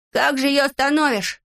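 high, indignant question; irritated high-voiced comeback; exasperated retort. Acted quarrel, Незнайка retorts.

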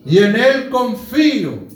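loud, slow, rising sermon climax; impassioned preaching. Real, not acted. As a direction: proclaim each phrase at full force. Preaches at full force.

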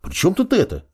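high-pitched indignant question. A narrator's reading. Apprentice to nagging girlfriend, indignant. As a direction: object indignantly, pitch jumping up. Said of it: "возмутился Мак".

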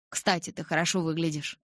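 plain reading voice

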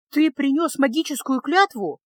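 выше и громче, с ударением на «Ты»; испуганно выкрикивает вопрос Кайлу; восклицание. A narrator's reading. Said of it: "воскликнула ученица". (взволнованное восклицание, выше и громче)

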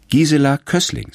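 a hint of an eager child reciting her name; little girl voice. A narrator's reading. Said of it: "sagt sie". (leicht gespielte Kinderstimme, höher)

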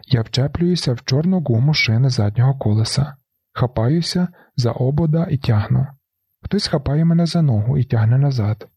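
ordinary delivery, nothing marked

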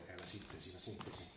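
barely audible, low musing; muttering to himself. Acted. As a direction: mull the word over under your breath.

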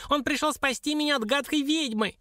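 very high excited squeal; high-pitched, triumphant squeak; squealing dwarf. A narrator's reading. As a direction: squeal the news like a tiny imp. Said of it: "верещал малютка".

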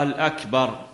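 الأكبر with a clear al- before akbar; added article. Shown as a demonstration. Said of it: "ماذا أضاف؟".

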